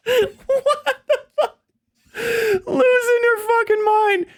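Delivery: high, cracking, laughing incredulity; losing it with laughter, pitch way up; laughing disbelief. Real, not acted.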